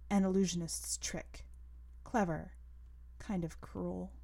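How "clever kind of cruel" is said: plain reading voice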